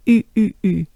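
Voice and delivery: rounded French U, lips pushed out; French U sound. Shown as a demonstration. Teacher demonstrating the French U sound. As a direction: blow it out like into a trumpet. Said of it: "a little opening of your mouth".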